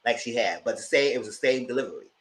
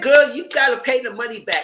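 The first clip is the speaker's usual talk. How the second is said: raised, higher scolding voice; scolding call-out; 12 semitones higher, 7 dB louder than usual. Retold or imitated. Telling Erica to pay the money back, loud and scolding. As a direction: tell her off loudly and high. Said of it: "I said".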